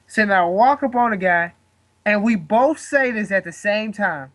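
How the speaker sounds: usual speaking voice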